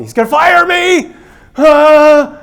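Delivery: a high, loud mock-panic yell ending in a scream; panicked cry. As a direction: shriek it as if sure you'll be fired. Acting out panicked fearful thoughts.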